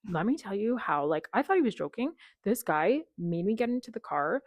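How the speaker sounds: ordinary delivery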